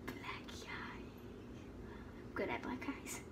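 soft unvoiced whisper. Real, not acted. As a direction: breathe the words, no voice. Whispers while applying makeup.

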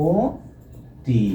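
অতি split slowly into "o... ti"; syllable-by-syllable pronunciation. Shown as a demonstration. Teacher dictates the pronunciation for writing, অতি slowly as "o... ti". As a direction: draw out অতি as a long closed o, then "ti". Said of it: "উচ্চারণ হচ্ছে".

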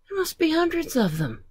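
very high, quick storybook voice; amazed character voice. Acted. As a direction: squeak it out in amazement.

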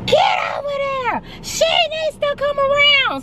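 high-pitched imitation of a hollering stranger; high sustained mock yell. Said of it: "why was you hollering at my baby".

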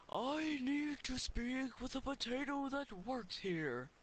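put-on high, slow, strange old man voice; old wizard voice. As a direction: speak like an odd old wizard.

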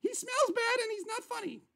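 high-pitched disdainful diva. Acted. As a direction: complain up high like a snubbing star.

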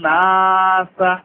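নাসা drawn out long; long nasal stretch. Shown as a demonstration. Teacher modelling the stretch in নাসা. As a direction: hold নাসা. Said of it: "ও র I তান".

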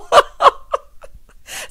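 airy laugh at her own rant; a real laugh that breaks off; a real laugh after the mock grilling; breathy laugh. Real, not acted.

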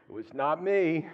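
hammed-up plea of innocence; mock indignant denial. Acting out Peter denying being snitch.

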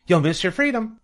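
high goose voice; honking goose. A narrator's reading. The goose pleads with Wilbur to stay free, honking. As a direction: honk the warning out high. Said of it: "honked the goose".

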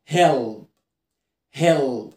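هيل with a thick final l, held; low, deliberate هيل ending "ull"; dark L. Shown as a demonstration. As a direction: let the l of هيل sink back. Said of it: "نحفظ وننطق صح".

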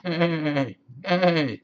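high drawn-out neigh; whinny like a horse, falling away. A narrator's reading. Horse greeting a spider for young listeners, neighing. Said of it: "said the horse".

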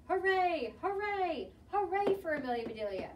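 high, loud hoorays, easing off; roaring cheer. A narrator's reading. Team celebrating their player, loud cheer. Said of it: "she heard a loud roar".

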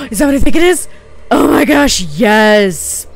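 high, very loud squeal of excitement; real thrilled outburst; ecstatic discovery. Real, not acted. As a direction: shout it, overjoyed and disbelieving. Finds another wanted item.

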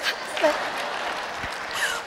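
a breathy laugh under the breath; soft laugh. Real, not acted. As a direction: laugh quietly at your own joke. Laughs at own Matrix joke.

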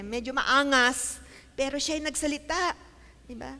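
normal talking voice

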